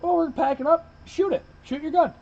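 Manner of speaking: egging him on, high and insistent; urging shout. Retold or imitated. Hunters urge the newcomer to fire, a high insistent shout. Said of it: "We're like".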